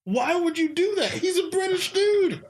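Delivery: high-pitched, exasperated disbelief; incredulous outburst. Real, not acted. Baffled podcaster protesting a British actor's fake accent, high and incredulous. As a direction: voice shooting up in baffled protest, close to laughing.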